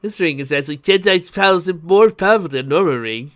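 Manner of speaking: loud, energetic inventor's explanation; exulting. A narrator's reading. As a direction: boast excitedly about the discovery. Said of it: "he exulted".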